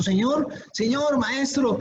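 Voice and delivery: a mock cry for help to the Lord; desperate plea. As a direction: beg the master in a panic. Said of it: "están desesperados y están diciendo".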